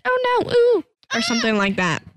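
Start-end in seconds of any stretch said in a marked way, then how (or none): 0.1-0.8 high squealed oh no; mock panic. Retelling their panic at the glitch, mock squeal. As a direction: squeak it in fake alarm. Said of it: "We just said".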